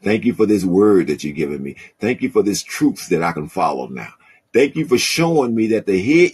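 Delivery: rising, fervent spoken prayer climbing high. Shown as a demonstration. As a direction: pray it out, swelling with praise. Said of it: "Tell him".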